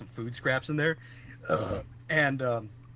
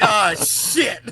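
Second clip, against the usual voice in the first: alarmed curse; 8 semitones higher, 10 dB louder than usual. Retold or imitated. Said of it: "I was like".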